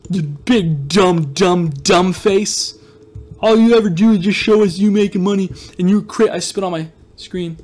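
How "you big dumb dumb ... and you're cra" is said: mock whiny kid complaining, a bit louder; hammed-up jealous viewer voice, slowing on dumb dumb face. Imitating a jealous viewer whining at the streamer. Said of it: "You're like".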